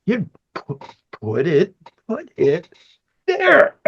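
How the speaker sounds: emphatic directing demo; with emphasis. Shown as a demonstration. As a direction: punch "there" with a big upward leap. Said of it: "with some emphasis".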